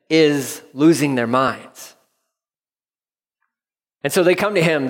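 ordinary talk

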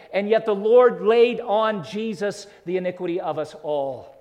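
loud, soaring pulpit delivery; full-force preaching. Acted. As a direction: build to a thunderous preach.

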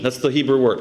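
normal talking voice

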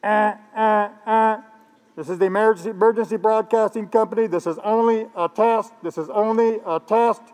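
a hammed-up emergency broadcast announcer. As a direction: announce it like a stiff TV alert test. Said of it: "They didn't get this warning like".

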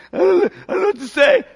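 high, fast flustered stammer; hammed-up tongue-tied fluster; mock panic. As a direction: act out someone panicking for words. Mimics a flustered witness.

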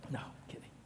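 a quick, unvoiced aside to the crowd; whispered aside. Real, not acted. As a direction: whisper the joke takeback. Aside after a joke.

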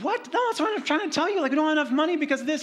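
high, loud, fast protest; retelling his shocked reply to a friend's advice; shrill disbelief. Retold or imitated. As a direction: squeal back in disbelief. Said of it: "I said".

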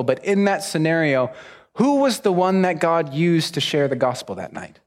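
ordinary talk

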